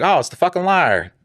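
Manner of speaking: high mocking jeer at the liar; mock-nasty cop greeting; sneering taunt. Detective mocking him at door.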